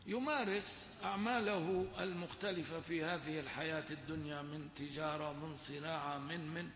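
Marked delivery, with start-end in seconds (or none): none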